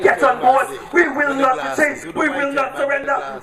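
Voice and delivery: usual speaking voice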